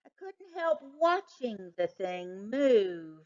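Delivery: usual speaking voice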